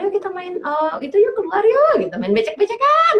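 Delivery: put-on mother coaxing her crying child outside to play, lively and high; coaxing a toddler. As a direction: lure the child away with bright enthusiasm.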